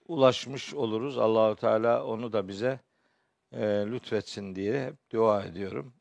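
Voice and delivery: normal talking voice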